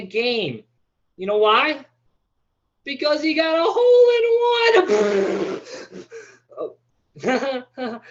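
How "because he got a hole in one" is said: high, loud, cracking shout; shouted punchline. Acted. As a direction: belt the punchline to the back row. Punchline yelled at the crowd.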